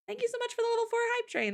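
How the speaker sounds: excited. Real, not acted. Streamer gushing thanks, very high.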